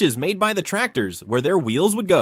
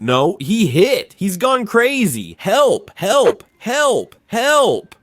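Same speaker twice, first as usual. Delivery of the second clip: louder, higher desperate shout; yelling for help; 6 semitones higher, 5 dB louder, much slower than usual. Retold or imitated. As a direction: scream help over and over. Dan yells for help.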